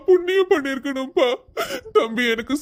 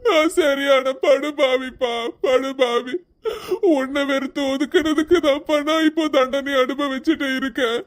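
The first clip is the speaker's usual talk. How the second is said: weeping remorse. Acted. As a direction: break down over his own guilt. Father weeps in remorse. (அழுகையுடன் வருந்தி)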